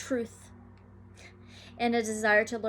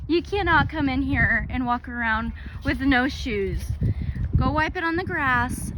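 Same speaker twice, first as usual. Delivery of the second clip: scolding; 2 semitones higher, 6 dB louder than usual. Real, not acted. Scolding child with no shoes.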